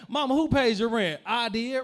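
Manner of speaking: mock confronting shout. Voicing himself confronting mom.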